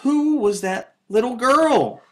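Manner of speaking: high, small bear-cub voice; Baby Bear. A narrator's reading. Teacher reading to class, Baby Bear asking in a high voice. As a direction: squeak it like a tiny curious cub. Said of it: "asked Baby Bear".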